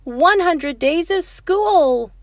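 high, loud joyful shout; Monkey cheers at the top of his voice. A narrator's reading. Monkey shouting to the class in triumph. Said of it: "shouted Monkey".

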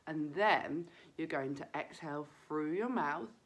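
ordinary delivery

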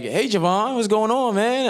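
high, lilting imitation of a director's greeting; Gerard. Director greeting the actor on Skype, imitated high and lilting. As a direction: draw out a friendly hey, rising high. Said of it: "he's like".

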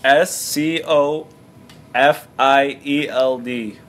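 ordinary talk

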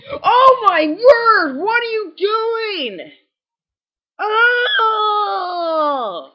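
cracking high shout of frustration. Real, not acted. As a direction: voice breaks on a yell. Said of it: "that was a major voice crack".

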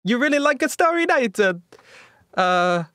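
put-on high Italian accent; puzzled artist in a bad Italian accent. Leonardo reacts to a player.

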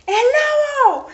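loud, high shout of alarm; startled yell at full voice; frightened cry. A narrator's reading. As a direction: cry out as a scared boy. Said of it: "exclamó Juan muy asustado".

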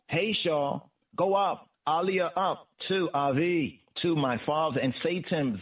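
ordinary talk

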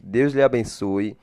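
ordinary delivery

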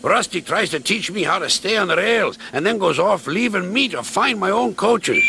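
gruff Duncan. Acted. Duncan speaks.

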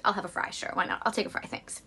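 whispered reluctant give-in; resigned whisper. Retold or imitated. Caving to the fries, whispered and resigned. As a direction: mutter it like you've given up.

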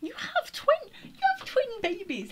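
high, loud squeal of disbelief with a laugh; squealing surprise at twin babies; shocked. Real, not acted. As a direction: shriek the news of twins.

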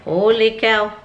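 usual speaking voice

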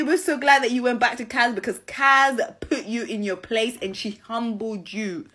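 ordinary talk, nothing marked

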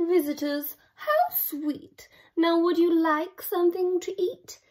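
sweet dragon. Acted. Dragon greeting the Vikings, sweetly gushing. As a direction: coo over the visitors. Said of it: "the dragon said".

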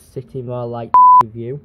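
playful loud proclamation; loud call-out. Real, not acted. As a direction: boom out the joke name for the view. Repeating joke nickname loudly.

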